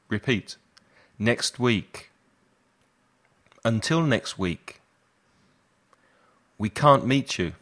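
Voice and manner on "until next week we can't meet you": ordinary delivery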